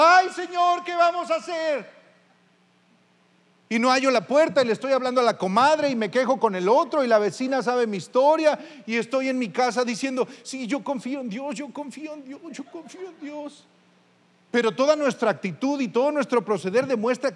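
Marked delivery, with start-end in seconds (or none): 0.0-1.9 desperate cry. Acted. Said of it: "que está gritando desesperado". 10.4-13.6 fading repetition. Acted. Anxious servant repeating his faith.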